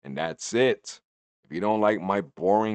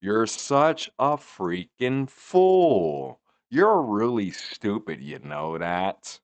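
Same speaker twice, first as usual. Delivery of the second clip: mocking John; 4 semitones higher, 7 dB louder, much slower than usual. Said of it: "John was like".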